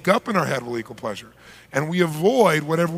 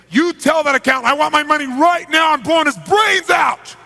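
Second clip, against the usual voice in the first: much higher, loud screaming threat; 10 semitones higher, 7 dB louder than usual. Retold or imitated. Mafia boss screaming at the interpreter, threatening to shoot. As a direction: scream it with a gun to his head. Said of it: "started screaming".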